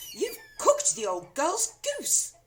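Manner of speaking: high, loud, slow blame; glaring accusation. A narrator's reading. Wife accusing her husband. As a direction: glare and accuse. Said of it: "glaring at her husband".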